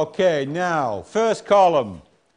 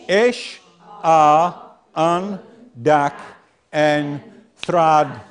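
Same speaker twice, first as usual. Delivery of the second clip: each syllable said apart with pauses; 4 dB louder, much slower than usual. Shown as a demonstration. Teacher sounding out a nonsense word for the class, each syllable apart. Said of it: "What does it say?".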